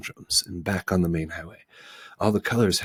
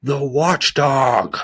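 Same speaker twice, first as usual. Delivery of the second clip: slow, louder alarmed cry; frightened shout; 8 semitones higher, 8 dB louder, much slower than usual. Acted. Lethargian crying out at the watchdog, frightened. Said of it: "shouted another".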